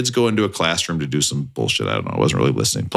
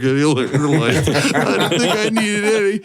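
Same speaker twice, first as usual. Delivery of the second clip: sheepish laugh; 7 semitones higher than usual. Retold or imitated. Retold sheepish reply.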